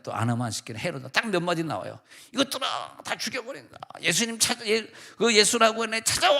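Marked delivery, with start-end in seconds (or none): 2.3-6.4 hammed-up villain ranting; Herod yelling. Child actor Herod ranting at soldiers, high mock yell. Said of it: "막 소리를 지르는 거야".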